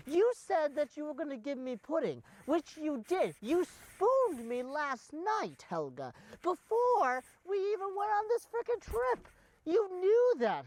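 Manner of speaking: high, outraged British protest; wounded, high-pitched British indignation; indignant accusation. Acted. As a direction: hurl the accusation, pitch climbing. Employer quarrels with carer over pudding.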